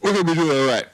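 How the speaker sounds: loud, high rallying cry; hyped cheer. Real, not acted. Host rallying the players with a loud cheer. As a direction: pump everyone up.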